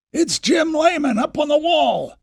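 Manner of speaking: calling out. Acted. Townsfolk calling out alarm.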